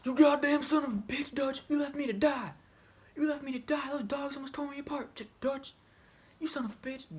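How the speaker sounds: high, loud enraged scream at the traitor; furious scream. Retold or imitated. John screams at Dutch with a shotgun, furious. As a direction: scream it, betrayed and left to die.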